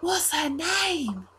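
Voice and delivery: put-on high, slow, wonderstruck boy; Reese. Altar boy asking about the bird, slow high wonder. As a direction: ask slowly in an awed child's voice. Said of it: "he's, like, holding on his wrist going".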